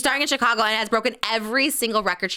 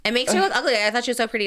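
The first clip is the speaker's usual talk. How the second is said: usual speaking voice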